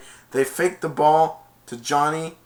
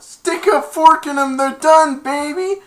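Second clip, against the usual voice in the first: high, loud triumphant boast; gloating cheer; 14 semitones higher, 11 dB louder, slower than usual. Retold or imitated. Player gloating over the other team, loud and high. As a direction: crow over the beaten team. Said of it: "he went".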